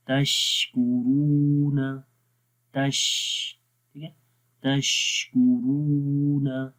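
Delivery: very slow तशकुरूना, the श breathy and long; recitation demo. Shown as a demonstration. Teacher demonstrates breath in the शीन. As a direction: draw out तशकुरूना with air hissing on श. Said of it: "शीन की आवाज़ में कैसे सांस जारी हो".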